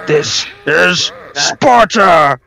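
slow, booming war cry; This is Sparta shout. Pretend. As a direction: bellow it like Leonidas. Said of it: "famous movie line".

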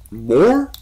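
high, rising disbelieving question; stifled shock. A narrator's reading. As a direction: try to keep the shock in. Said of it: "trying to hide his shock".

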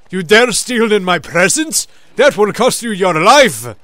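loud, high mock threat; hammed-up guard's warning; threatening guard. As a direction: bellow like an outraged guard. Imitating game guards, "those guys talk.

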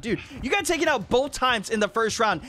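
normal talking voice

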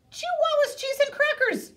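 high, quick cheer; excited exclamation. A narrator's reading. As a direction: blurt it out.

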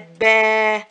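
"با" with the A held long and loud; long alif vowel. Shown as a demonstration. Teacher showing the alif of madd, lengthened vowel. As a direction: stretch "با" into a long baaa. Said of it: "زودنا ألف المد".

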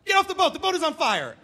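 high, very loud emergency shout; panicked dad yelling. Retold or imitated. Dad ordering family off burning boat, panicked yell. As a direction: bellow orders like a dad in full crisis. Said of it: "emergency crisis management mode and he flipped out".

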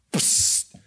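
a quick breathy hiss like a can popping open. Retold or imitated. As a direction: imitate a soda can being opened, all air.

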